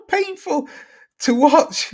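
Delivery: squeaky laugh breaking through the words; giggling through a friend's comment. Real, not acted. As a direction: read it while cracking up. Laughs while reading comment.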